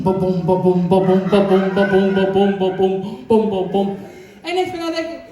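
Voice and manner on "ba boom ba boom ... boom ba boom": low chanted ba boom drumbeat; low mouth-drum ba boom rhythm; imitated movie drums. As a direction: beat out the soundtrack with your voice, lower. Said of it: "you hear this boom".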